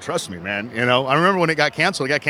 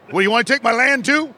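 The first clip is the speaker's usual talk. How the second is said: mock meltdown yelling; 8 semitones higher than usual. Vendor yelling after a man who took his picture, mock outrage. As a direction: holler after him in a hammed-up fury. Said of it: "he was screaming at that guy walking away".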